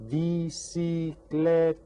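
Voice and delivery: slow, sustained, even syllables; syllables sounded out. Shown as a demonstration. Teacher presents a word for syllable counting, slow and even. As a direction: hold each syllable of "Bicicleta" evenly. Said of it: "How about this word?".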